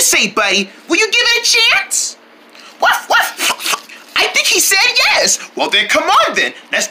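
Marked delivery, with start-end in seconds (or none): 2.8-4.2 puppy bark. Pretend. Puppy answers yes.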